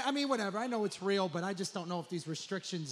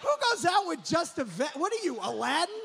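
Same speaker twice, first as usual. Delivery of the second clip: mocking; 8 semitones higher, 5 dB louder than usual. Real, not acted. Mocking the vest guy.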